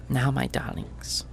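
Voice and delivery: sweet, soft, menacing auntie voice; treacle voice. Acted. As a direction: threaten sweetly and quietly. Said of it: "treacle voice".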